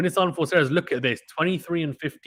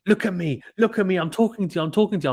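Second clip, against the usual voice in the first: higher, pushy mock yell at the umpire; retold angry shout; 7 semitones higher than usual. Medvedev yelling at the umpire, a mild mock shout. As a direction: act out the player's demanding shout, half-hearted. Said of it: "he's literally shouting him".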